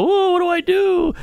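high squeaky panicked whoa; mock panic. Comedian mocking his helpless younger self, high and squeaky. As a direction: play the lost kid, voice jumping way up.

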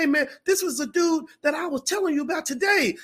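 excited fan. Retold or imitated. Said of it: "She's like".

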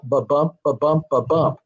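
"ba-bump" in even pairs, second syllable stressed; iambic beat. Shown as a demonstration. Writer showing the line is all iambic, stressed "bump". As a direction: tap out "ba-bump" with the weight on BUMP. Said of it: "That's all".

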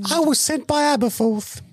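high squeaky elf; Dobby voice. Retold or imitated. Co-host playing Dobby in a high elf squeak. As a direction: squeak the line like a proud house elf.

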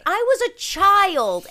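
mock indignant self-defense; imitating herself. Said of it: "I was like".

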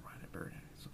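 breathy whispered aside; whispering. Real, not acted. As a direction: drop to a whisper. Aside that trails off.

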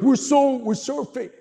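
high mock-frightened boys; scared boys. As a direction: voice the kids backing off in fear. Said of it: "they're like".

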